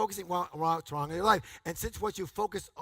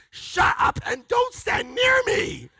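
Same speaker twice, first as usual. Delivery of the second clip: much higher, slow, laughing yell; laughing shout; 10 dB louder, slower than usual. Retold or imitated. Telling a complainer off, laughing shout. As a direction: blast him away.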